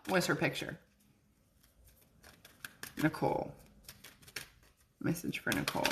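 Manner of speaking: low quiet mumble under her breath; muttering to herself. Real, not acted. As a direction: murmur it softly to yourself. Reading names quietly to herself.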